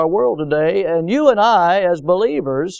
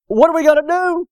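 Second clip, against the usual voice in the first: panicked servant; 9 semitones higher than usual. Acted. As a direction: burst in terrified to wake the prophet. Panicked servant asks Elisha.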